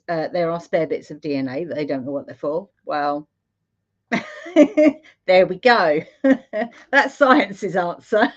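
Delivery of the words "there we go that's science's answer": amused, louder, with chuckles between phrases; real laughter punctuating a wry aside; laughing. Real, not acted. Meditation host poking fun at science's answer, laughing.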